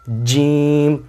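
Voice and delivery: جیم said very slowly and firmly, teeth pressed; a hard, drawn-out جیم ending on m; firm letter demo. Shown as a demonstration. Teacher models جیم to students, slow and firm. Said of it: "دانتوں کو دبا کر سختی کے ساتھ ادا کرنا".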